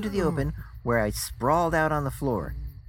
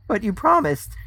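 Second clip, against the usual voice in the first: higher, louder objection; alarmed protest; 8 semitones higher, 8 dB louder than usual. A narrator's reading. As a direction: burst out in protest, voice jumping up. Narrator blanches and protests.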